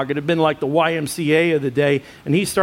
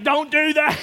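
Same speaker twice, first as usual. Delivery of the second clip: higher, sharp little shout; mock protest; 8 semitones higher than usual. Wife telling her husband to stop quoting scripture, sharp and higher. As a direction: snap back at him to stop.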